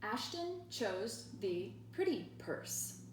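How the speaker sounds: slow, careful read-aloud; reading the sentence. Shown as a demonstration. Teacher reads the example sentence slowly to students. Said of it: "This sentence reads".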